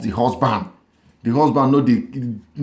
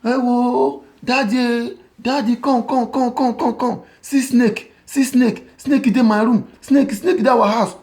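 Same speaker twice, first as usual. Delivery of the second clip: fake panicked cry; 13 semitones higher than usual. Wife faking fear to call her husband back, high panicked cry. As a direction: scream for daddy like the snake is real. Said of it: "this woman come burst cry o".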